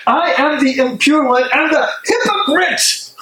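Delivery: a sudden high cry of self-disgust; anguished outcry. Acted. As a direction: fling the accusation at yourself. Self-accusing outburst in monologue.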